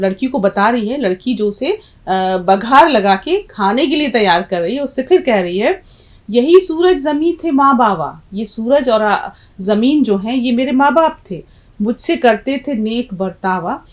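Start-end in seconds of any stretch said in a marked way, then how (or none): 6.3-8.2 raised poem recitation. Shown as a demonstration. The dal telling the girl about her parents, declaimed. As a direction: say the verse higher and louder.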